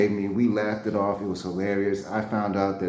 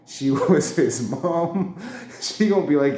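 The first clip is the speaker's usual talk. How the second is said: slow words through a laugh; laughing at his own blunder; 3 semitones higher, 4 dB louder than usual. Real, not acted. Retelling calling the wife his mom, laughing.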